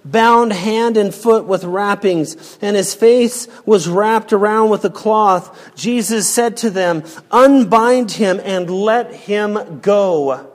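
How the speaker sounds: slow, solemn Bible reading building to a cry; scripture reading. Acted. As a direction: read the passage slowly and with gravity. Reads the scripture passage aloud.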